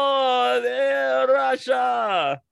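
high, loud hammy Russian shout; mock Russian accent. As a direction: overdo a Russian accent, rolling the R. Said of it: "That's Russian? It sounded Irish".

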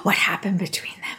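whispered gossip; gossipy whisper. Pretend. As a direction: hush it like juicy gossip. Imagined gossiping mourners.

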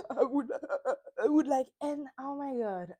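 imitating Tom's fake sobbing, voice wobbling; mock fake crying. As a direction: ham up a fake tearful start. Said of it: "starts fake crying".

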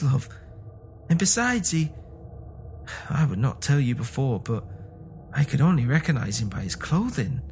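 faltering, halting plea, often high-pitched; shaking voice. A narrator's reading. Old man to wife about their dead son, voice shaking. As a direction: break the dreadful truth haltingly. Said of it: "and his voice shook".

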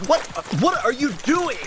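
high, loud, incredulous shout; alarmed yell. Acted. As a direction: yell in disbelief after your partner. Alarmed at partner's reckless chase.

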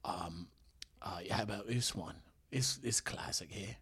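hushed British star introducing his next bit; Jason Statham. Acted. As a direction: whisper it haltingly into the mic. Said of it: "whisper it a little bit".

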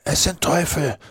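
very high, strained, panicked voice; terrified boy in a frantic stage whisper; shuddering whisper. A narrator's reading. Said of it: "Schaudernd flüsterte Huckleberry".

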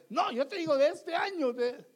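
loud, high mock protest; angry wife. Wife insists on a new car, loud and indignant. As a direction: imitate an indignant wife cutting in. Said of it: "la esposa está enojada y te dice".

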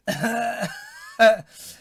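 high, loud burst of laughter; real laugh bursting out. Real, not acted. Host reacting to an unreadable comment, loud laugh.

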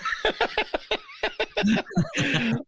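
sharp high burst of laughter. Real, not acted. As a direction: crack up at your own joke. Laughing at his own remark.

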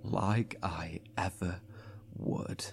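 slow, low mocking whisper; quiet scornful defiance; defiant whisper. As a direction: sneer it under your breath. Defiant whisper after a laugh.

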